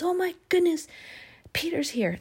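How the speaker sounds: high, breathless squeal of news; excited servant girl. Acted. Servant girl telling the praying people, high and thrilled. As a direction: Rhoda bursting in with the news.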